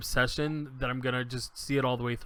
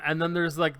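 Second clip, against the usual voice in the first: ordinary talk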